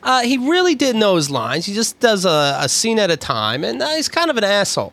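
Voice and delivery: high, put-on celebrity voice; celebrity interview voice. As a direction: answer like a candid movie star. Plays celebrity answering interview.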